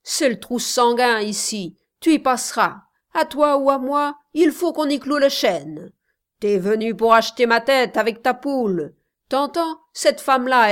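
loud, fast menacing shouts; hit hard crying out. Acted. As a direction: yell threats at the rival.

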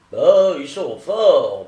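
loud, slow, drawn-out exclamation; grudging amazement. A narrator's reading. Attorney reflects on the intruders' skill, grudgingly amazed. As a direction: stretch it out, impressed by their nerve. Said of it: "pensa Hobbes".

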